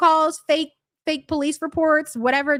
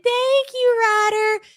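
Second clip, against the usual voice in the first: high, sugary mock thank-you, much higher; mock sweet voice; 9 semitones higher, slower than usual. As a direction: fake innocence, syrupy and high. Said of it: "pretending to be sweet and innocent".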